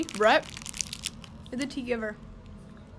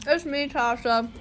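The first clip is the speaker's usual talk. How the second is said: ordinary delivery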